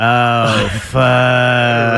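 drawn-out slow-motion dread; Ralphie. Acted. Watching disaster unfold like a movie kid, drawn-out dismay. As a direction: stretch each word out in mock horror. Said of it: "I was like Ralphie in A Christmas Story".

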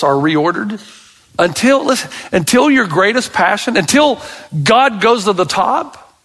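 insistent preaching, repeating until; rising sermon push on until; building exhortation. Acted. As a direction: drive each until home.

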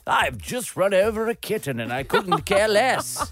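lightly voiced theatre gent; a hint of a grand stage voice; theatre darling voice. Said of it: "say anything in that voice".

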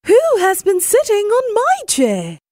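high, quick indignant cry; Mama Bear. A narrator's reading. Mother bear finds her chair sat on, high cry. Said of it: "And Mama Bear cried".

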